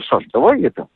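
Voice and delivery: ordinary talk, nothing marked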